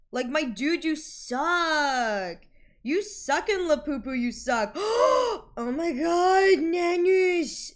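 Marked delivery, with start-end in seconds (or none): 4.7-7.7 high, loud, slow amazed cry; excited gasp. Real, not acted. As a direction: gasp it out in delight. Gasp, excited reaction to name.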